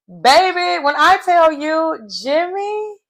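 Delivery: high, loud, breathless lead-in; excited build-up. Real, not acted. Telling viewers about the reality show twist, high and excited. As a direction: gush to the viewers before the big reveal. Hyped retelling of a shock.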